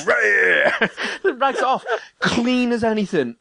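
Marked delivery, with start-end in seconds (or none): 0.1-1.3 mock roar. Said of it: "she's like".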